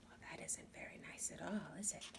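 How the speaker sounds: put-on hushed whisper to the child; whispered aside. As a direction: confide it softly to the listener.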